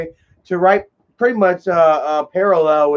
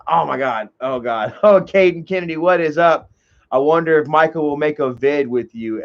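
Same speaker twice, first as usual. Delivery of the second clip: ordinary talk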